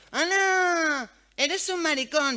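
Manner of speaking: put-on high, loud, sing-song taunting kid; mocking taunt. Schoolkids insult her son, high mocking jeer. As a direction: imitate a schoolyard bully jeering, slowly.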